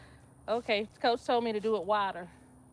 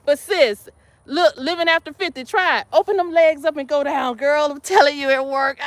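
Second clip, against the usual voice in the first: loud urging; 4 semitones higher, 8 dB louder than usual. Real, not acted. Urges a friend loudly.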